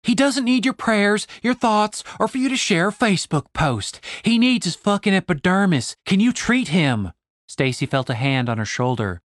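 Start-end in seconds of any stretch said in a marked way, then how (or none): 0.0-7.1 high, heated tirade; exasperated rant. Acted. Stacy rants at Dave that Julian needs real healing, high and heated. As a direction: let the frustration boil over.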